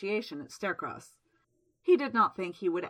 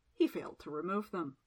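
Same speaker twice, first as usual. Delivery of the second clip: ordinary delivery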